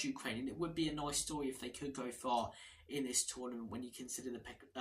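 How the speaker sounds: ordinary talk, nothing marked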